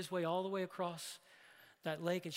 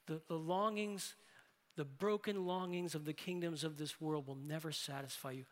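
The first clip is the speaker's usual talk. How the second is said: ordinary talk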